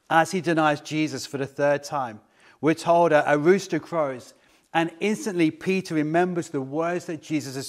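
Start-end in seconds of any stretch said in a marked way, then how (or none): none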